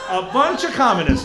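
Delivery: loud hammed-up Texan indignation; Texan farmer accent. Farmer accusing students, loud Texan accent. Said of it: "if you're gonna talk with that accent".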